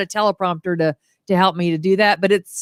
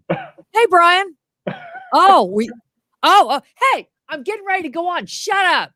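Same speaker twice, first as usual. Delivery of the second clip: fake phone call; 8 semitones higher than usual. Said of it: "fake phone call to be like".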